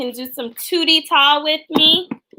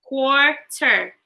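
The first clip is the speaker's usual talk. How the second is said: "Quarter" split into two spaced beats; syllable break. Shown as a demonstration. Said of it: "How many syllables does quarter have?".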